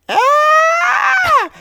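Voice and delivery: long, high-pitched imitated shriek; mock scream. Mother attacked by a huge bird, imitated scream. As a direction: shriek loud and high, holding it. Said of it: "And she screams".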